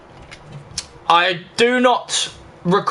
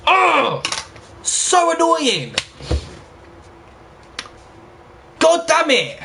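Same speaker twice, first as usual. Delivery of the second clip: frustrated groan; 10 semitones higher, much slower than usual. Real, not acted. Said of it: "I'm pissed".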